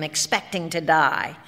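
usual speaking voice

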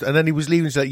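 usual speaking voice

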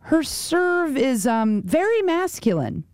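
slow, hesitant mock-quote with pauses; searching for tactful words. Commentator seeking a politically correct phrase, slow and hesitant. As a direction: grope slowly for a careful word. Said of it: "looking for a politically correct way".

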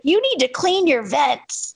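mom's high, slow blunt demand; drawn-out, high-pitched mock reproach; imitated scolding. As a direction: tell him off as your tactless mother. Mom scolding doctor, acted out.

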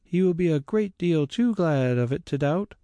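put-on a hopeful girl sure of her father; young Grace. A narrator's reading. Grace answers the carrier, eager young girl voice.

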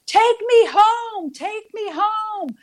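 high, slow, drawn-out plea, calling out; mock yelling. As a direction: stretch each word high and slow like a lost woman calling. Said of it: "I hear this woman yelling".

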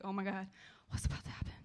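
whispered nervous aside; a scared whisper before the megaphone comes out. Retold or imitated. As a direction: breathe it out, scared of what's coming. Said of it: "I'm, like, real nervous".

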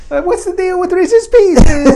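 high, slow, loud cartoon voice; high comic voice. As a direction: squeal it out through a laugh. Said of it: "You went the other way with it".

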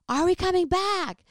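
high, loud shouted question; retold shout; calling out. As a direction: call across to the brother. Calling out across distance.

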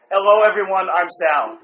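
loud mock replay of a guest's intro; mocking imitation. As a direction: parrot the introduction loudly, teasing. Host loudly replays guest's weak introduction.

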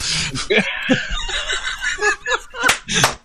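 long high cackle; high laugh. Real, not acted. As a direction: burst of laughter. Laugh alone.

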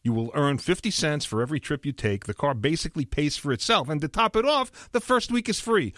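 upbeat radio ad read. Acted. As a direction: pitch it like a sponsor spot. Host reads live sponsor ad.

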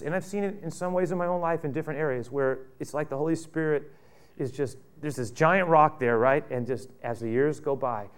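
ordinary talk

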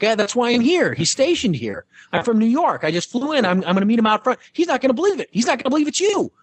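high-pitched excited fan voice. Retold or imitated. Fan explains her boyfriend will be amazed, high excited chatter. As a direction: babble excitedly about the boyfriend. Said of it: "but she's like".

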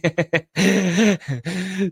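real burst of high laughter; amused laughing fit at the phone. Real, not acted. Laughing continues.

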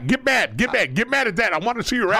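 hammed-up dad daring his son to cry; mocking taunt. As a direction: goad him to cry like a mocking father.

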